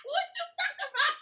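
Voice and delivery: high, loud tearful shouting at the Lord; furious weeping protest, pitched high and loud; crying angry outburst at God. Retold or imitated. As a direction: sob and rage up at heaven. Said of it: "I immediately got angry".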